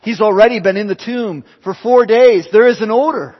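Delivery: loud, high-pitched protest from the pulpit; acted objection. Preacher voices the sisters objecting at the tomb, loud acted protest. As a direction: voice the sisters' alarmed objection at full preaching force. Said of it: "They object".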